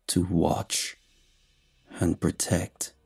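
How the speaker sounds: soft, eerie creature voice; quiet inhuman voice. A narrator's reading. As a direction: breathe it low and strange. Monster's quiet answer.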